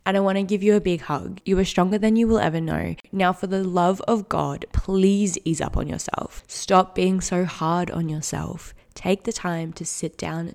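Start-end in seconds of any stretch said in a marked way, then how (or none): none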